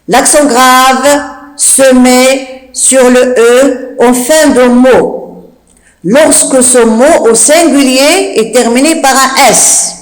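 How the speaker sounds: slow, phrase by phrase with pauses; measured chunks, words drawn out; slow dictation. Shown as a demonstration. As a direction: dictate it for pupils writing it down. Rule repeated slowly for dictation.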